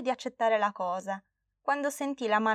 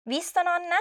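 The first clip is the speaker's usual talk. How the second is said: put-on high, proud child exclaim; proud exclaiming girl; 8 semitones higher than usual. Girl proudly shows grandma her cake, high child voice. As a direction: show off to grandma. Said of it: "esclamò Flavia, fiera di sé".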